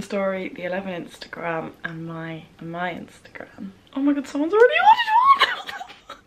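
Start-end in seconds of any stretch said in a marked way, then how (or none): 3.9-6.2 real excited squeal. Real, not acted. Vlogger seeing the first order, excited squeal. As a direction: squeal it out in thrilled disbelief.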